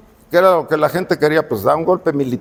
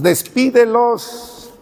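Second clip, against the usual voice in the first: drawn-out plea; 7 semitones higher, much slower than usual. Retold or imitated. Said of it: "les dicen los discípulos a Jesús".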